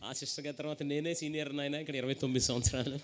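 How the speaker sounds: low, soft wheedling plea; compromising old believer. Retold or imitated. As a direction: beg God to excuse this once, in a low voice. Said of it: "మళ్ళీ, మళ్ళీ వచ్చారు".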